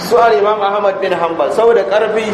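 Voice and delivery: normal talking voice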